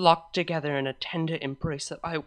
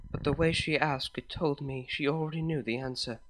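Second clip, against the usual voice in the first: ordinary delivery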